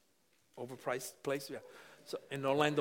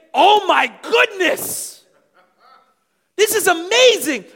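slow, loud, high gasp of delight; passionate awe; 13 semitones higher, 16 dB louder, slower than usual. Retold or imitated. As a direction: savor it like the best moment of life. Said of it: "so intense and so passionate".

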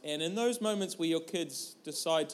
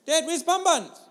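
put-on high, louder child voice pressing the question; insistent little girl; 11 semitones higher, 7 dB louder than usual. Daughter demanding an answer from Dad, high and louder. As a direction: she asks again, more urgent.

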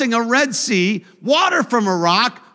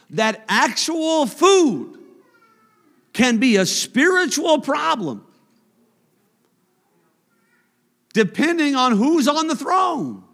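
loud, higher, slowed climax; preaching at full force; 7 semitones higher, 3 dB louder than usual. Acted. Preacher driving home God's punishment, loud preaching. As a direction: thunder it out, stretching each phrase.

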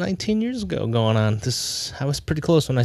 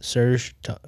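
ordinary talk, nothing marked

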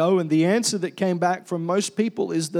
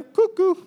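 high-pitched singsong "cuckoo"; mock cuckoo call; 11 semitones higher, 3 dB louder than usual. Preacher teasing those who over-Christianize things, high cuckoo call. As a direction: chirp it high like a cuckoo clock. Mimics calling someone crazy.

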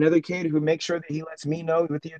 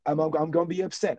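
usual speaking voice

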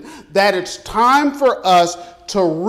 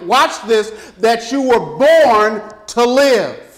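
raised, ringing preacher's challenge; booming motivational speech; 3 semitones higher, 3 dB louder than usual. Acted. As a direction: push it out like a revival preacher. Preacher builds to full force.

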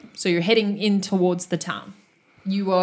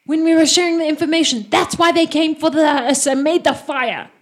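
angry; 7 semitones higher, 6 dB louder than usual. Acted. NPC continues blaming the party.

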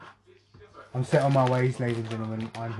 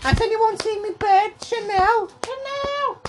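very high-pitched wailing call; Scouse bird owner; slower than usual. Acted. Scouse impression, calling lost bird.

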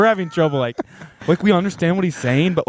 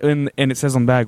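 usual speaking voice

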